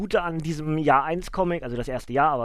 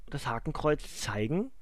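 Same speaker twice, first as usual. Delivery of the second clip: usual speaking voice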